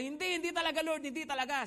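high, pressing denial, every "hindi" leaned on; emphatic insistence. Retold or imitated. Preacher voices Peter vowing to the Lord, high and insistent. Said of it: "talaga pong pinagdiinan niya".

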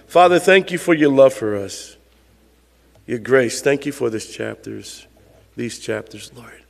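soft, low pastoral prayer. Real, not acted. Pastor leading the church in closing prayer, softly. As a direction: pray quietly and earnestly to the Father.

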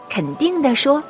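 ordinary delivery

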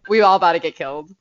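hammed-up fear that a killer's at the door; half-joking alarm, rushing the words; panicked inner voice. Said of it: "my brain is like".

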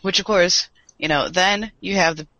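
ordinary talk, nothing marked

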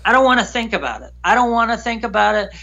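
fast, anxious repetition; a touch of nervous refusal; retold agitation. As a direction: rush it out, pushing the thought away. Refusing to face it.

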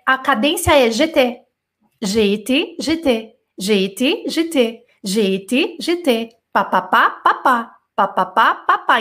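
beating out the syllables in a steady pulse; rhythm drill. Shown as a demonstration. Teacher drilling students on the rhythm, beat by beat. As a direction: tap the rhythm pá pá pá evenly. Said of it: "perceba a cadência".

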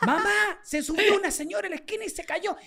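put-on high, insistent kid voice; retold child's pleading. Child explaining the fall to mother, high insistent imitation.

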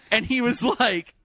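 ordinary delivery

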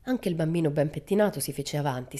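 ordinary delivery, nothing marked

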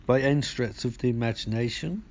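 normal talking voice

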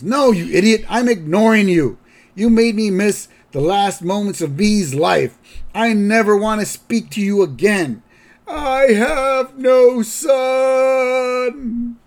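put-on high, loud old man scolding, slowing on the last words; angry grandpa. Grandpa disowns his son, loud high mock rage. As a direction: rant at your son, then drag out "I have no son". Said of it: "To which Abe goes".

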